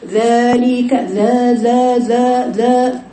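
ذلك with a thick, emphatic ذ repeated; تفخيم "ذا" خطأً مع التكرار; wrong heavy dhal. Shown as a demonstration. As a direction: hammer the ذا syllable heavily, over and over. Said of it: "تقرأ هكذا".

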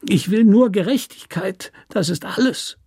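almost whimpering; a touch of a whimper; near whimper. Acted. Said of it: "Er wimmerte jetzt beinahe".

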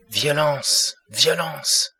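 put-on high, alarmed cry of an old man; frightened outcry. A narrator's reading. Old David bursting into the parlour, crying out in alarm. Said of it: "s'écria-t-il".

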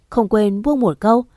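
usual speaking voice

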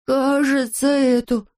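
high, hesitant confession; reluctant little admission; sheepish admission. A narrator's reading. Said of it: "признался Пончик".

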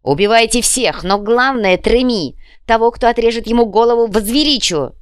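loud, high battle command; rallying shout. A narrator's reading. Джакомо orders his warriors to kill, rallying shout. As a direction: urge the warriors on at full voice. Leader rallies warriors before attack.

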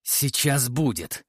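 slow, forced through gritted teeth. Acted. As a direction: grind it out through clenched teeth. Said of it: "процедил я сквозь зубы". (сквозь стиснутые зубы)

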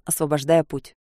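plain reading voice

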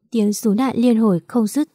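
plain reading voice

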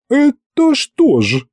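high, slow indignant hag; angry witch. Acted. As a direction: draw it out in outrage. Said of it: "разгневанной тётки Ягуты". (гневный голос Ягуты)

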